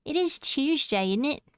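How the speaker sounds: cutesy British. Pretend. Streamer hamming up Britishness for chat, kawaii British accent. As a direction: play it cute and British, rising at the end. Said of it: "So kawaii and British".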